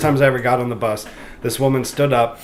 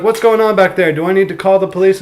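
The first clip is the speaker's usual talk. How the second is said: quoting the bus driver; 6 semitones higher, 5 dB louder than usual. Retold or imitated. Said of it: "the bus driver's like".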